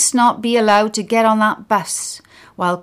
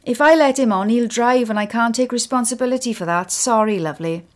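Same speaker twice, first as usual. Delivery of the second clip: bus driver refusing; 5 semitones higher, 4 dB louder than usual. Acted.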